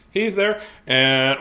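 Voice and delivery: usual speaking voice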